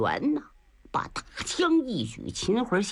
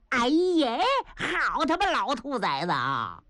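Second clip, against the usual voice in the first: gruff general surprised; 7 semitones higher than usual. Acted. Niu Gao spotting Qin Hui.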